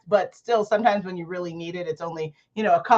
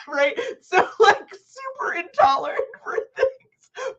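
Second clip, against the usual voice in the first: laughing; slower than usual. Real, not acted. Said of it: "laughing while confessing".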